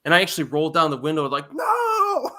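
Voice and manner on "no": high, laughing cry of no; mock outcry. Friends in the car yelling at him, high laughing outcry. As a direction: yelp it out high through a laugh.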